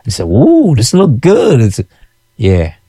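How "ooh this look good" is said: high, drawn-out admiring ooh; impressed customers. Retold or imitated. Nurses reacting to the curry crab, mock impressed. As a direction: coo over tempting food, stretching it out.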